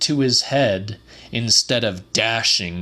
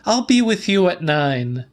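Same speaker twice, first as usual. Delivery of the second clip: firm and high for Celia; Celia's voice; 7 semitones higher than usual. A narrator's reading. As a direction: Celia setting the time, higher. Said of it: "said Celia".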